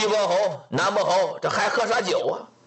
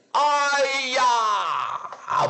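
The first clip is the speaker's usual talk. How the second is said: anguished cry out; 5 semitones higher than usual. Acted. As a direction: cry out before fainting. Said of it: "江环楚大叫一声".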